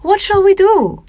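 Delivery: put-on a lost child pleading in the dark forest; panicked plea. A narrator's reading. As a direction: cry out, lost and scared.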